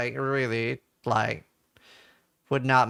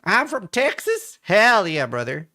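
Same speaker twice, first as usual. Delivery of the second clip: slow, higher drawled Texan accent; 4 semitones higher, 6 dB louder, slower than usual. Shown as a demonstration. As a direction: put on a big Texas accent. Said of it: "change the way you sound".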